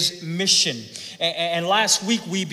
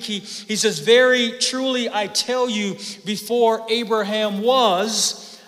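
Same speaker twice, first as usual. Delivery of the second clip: higher and louder, slowing on the key lines; fervent preaching; 5 semitones higher, 4 dB louder than usual. Acted. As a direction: drive the promise home from the pulpit. Preacher builds to full force.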